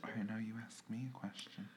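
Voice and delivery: unvoiced whisper. Real, not acted. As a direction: whisper the handover. Hushed handover.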